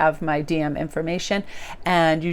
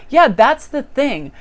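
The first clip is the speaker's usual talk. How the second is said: higher, louder, slow drawn-out agreeing; mock eager agreement; 7 semitones higher, 6 dB louder, slower than usual. To the gossiping post office ladies, eagerly agreeing, higher and slow. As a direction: play along eagerly, stretching each word. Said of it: "But I was like".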